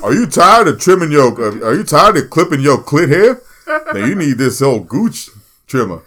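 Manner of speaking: booming parody sales pitch; mock infomercial pitch. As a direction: sell it like a cheesy TV commercial. Mock commercial pitch for a trimmer.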